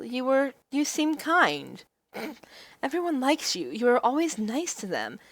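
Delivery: convince him, flustered; earnest heroine. Acted. Heroine protesting.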